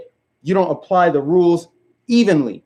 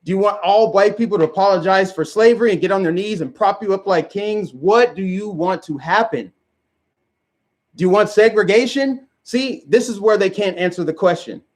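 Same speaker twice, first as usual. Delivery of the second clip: ordinary talk, nothing marked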